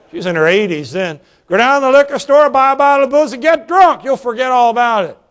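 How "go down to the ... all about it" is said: louder, higher, pressing the order; insistent order. Retold or imitated. Pastor repeating his order to the widow, louder and insistent. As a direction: push her firmly to do it.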